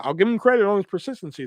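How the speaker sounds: ordinary delivery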